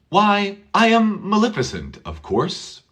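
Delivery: Why I am Maleficent said with a falling tune, not a question; statement why. Shown as a demonstration. Teacher modelling Maleficent's old-fashioned statement. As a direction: say why as a proud declaration, falling at the end. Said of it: "It's like".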